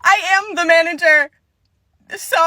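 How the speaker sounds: high, loud customer-service voice; employee asserting herself. Acted. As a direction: announce it in a high, polite phone voice. Employee answers demand for manager.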